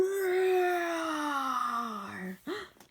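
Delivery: a sudden cry that swells and rises; loud gasping cry. Acted. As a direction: let out a big gasping cry. Creature finds its voice.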